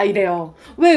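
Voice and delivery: ordinary talk, nothing marked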